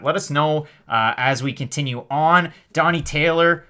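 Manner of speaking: ordinary delivery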